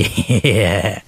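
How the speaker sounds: low gloating chuckle; evil laugh. Acted. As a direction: chuckle at his ruin. Villain gloats. (খলনায়কের নিচু হাসি)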